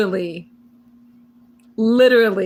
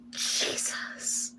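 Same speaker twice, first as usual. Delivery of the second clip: breathless whisper; 15 dB quieter than usual. Real, not acted. Breathless after laughing fit.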